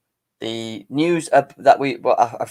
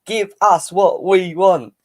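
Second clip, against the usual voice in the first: louder, insistent chant, the second take slower; mock Chelsea board demand; 6 semitones higher, 5 dB louder, much slower than usual. Chelsea board voiced demanding the fans' money, loud mock chant. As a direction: hammer it home like a greedy club board. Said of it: "Chelsea board, I'm talking about".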